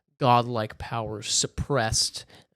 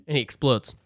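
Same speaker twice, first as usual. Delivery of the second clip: normal talking voice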